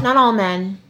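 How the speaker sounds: ordinary delivery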